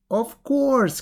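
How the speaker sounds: high, loud mock fright; pretend afraid. A narrator's reading. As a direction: cry out in feigned alarm. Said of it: "pretending to be afraid".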